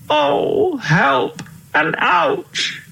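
very high, slow, drawn-out wail; hammed-up high wail of help and ouch; wailing cry. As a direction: stretch the whine out like a worn-out old king. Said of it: "cried the king".

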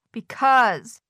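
a touch of a whiny wail; mild wail. Acted. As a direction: plead it with a light wail. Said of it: "wailed Toad".

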